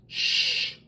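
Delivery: soft, drawn-out shush; hushing. A narrator's reading. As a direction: shush them gently. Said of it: "Goose hushed".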